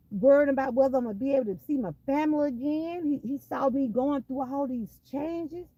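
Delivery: rising preacher's cadence, pushing harder; preaching. Real, not acted. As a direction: testify to the congregation with growing force. Preaching to the congregation.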